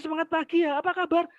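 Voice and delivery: high, lively good-morning cheer; energetic class greeting. Retold or imitated. Teacher cheering a gloomy class, high and lively. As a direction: pump the words up high with energy.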